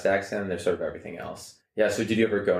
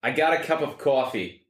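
higher-pitched New Jersey accent; 6 semitones higher than usual. Shown as a demonstration. Third accent in a guessing game, New Jersey. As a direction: lean into Jersey vowels on coffee. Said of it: "actually, it was three".

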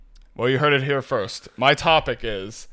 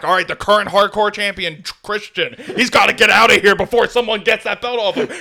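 higher, louder wrestling announcer call; 7 semitones higher, 7 dB louder than usual. Retold or imitated. Retelling the Hardcore title chase as an excited announcer. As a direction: hype it like a ringside commentator. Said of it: "throw it back".

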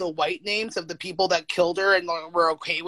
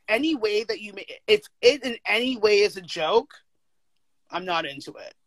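normal talking voice

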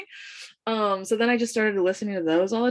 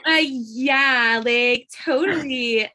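put-on drawled Valley Girl "like, totally"; slow, bored Valley Girl voice; Valley Girl accent; 5 semitones higher, 3 dB louder, much slower than usual. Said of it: "Valley Girl accent".

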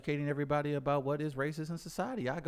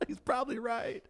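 laughing; 13 semitones higher, 4 dB quieter than usual. Real, not acted. Laughing admission.

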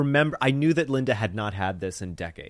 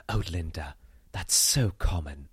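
lightly voiced friend, lower and drawn out; disapproving British friend; 5 semitones lower than usual. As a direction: gently imitate a disapproving friend. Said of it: "her friend was like".

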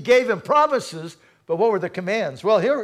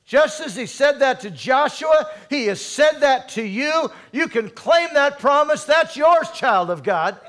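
louder and higher, rising to a ringing climax; preaching at full force; 6 semitones higher, 3 dB louder than usual. Acted. Preacher proclaiming the promise to the church, rising and louder. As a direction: build it up and proclaim it.

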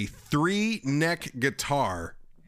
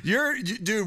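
ordinary talk

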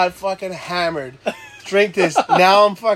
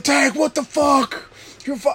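panicked shout; 11 semitones higher than usual. Retold or imitated. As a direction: yell in panic at your friend. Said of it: "They're like".

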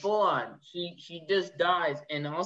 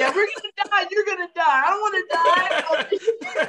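over-the-top yelling; 10 semitones higher, 4 dB louder than usual. Retold or imitated. Mimicking the loud actor from Aliens, laughing mock yell. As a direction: ham up the actor's dramatic panic. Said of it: "He was so loud".

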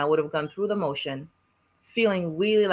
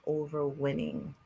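quiet; 10 dB quieter, slower than usual. Acted. Solemn quiet theme statement.

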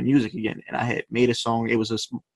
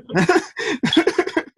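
burst of high laughter. Real, not acted. Laughing at his own punchline.

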